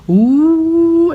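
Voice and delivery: high, loud mocking ooh; class teasing ooh. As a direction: tease like a whole class. Whole class teasing reaction.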